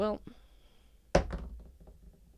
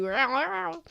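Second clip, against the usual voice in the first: cat meow; 7 semitones higher than usual. Acted. Cats meowing.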